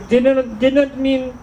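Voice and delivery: high-pitched mock Indian accent; accidental Indian accent. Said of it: "I turned Indian there a little bit".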